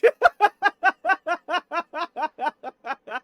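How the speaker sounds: high loud laughing fit; breaking up at the silly name; big laugh. Real, not acted. Streamer to teammate, dissolving into loud high laughter.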